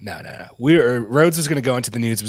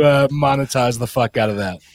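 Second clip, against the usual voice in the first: ordinary delivery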